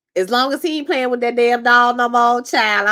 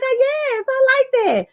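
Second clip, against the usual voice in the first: high-pitched approving coo; mock delight; 12 semitones higher than usual. Viewer savoring the actor's accent, mock delight. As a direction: react with playful, exaggerated pleasure.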